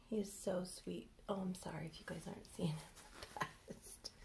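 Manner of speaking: quick hushed whisper. Real, not acted. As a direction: keep it to a whisper. Whispering to viewers.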